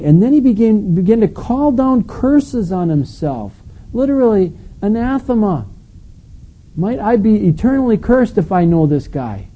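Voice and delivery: very high, intense preacher's delivery; impassioned preaching. Real, not acted. Preacher recounting Peter calling down curses, high and impassioned.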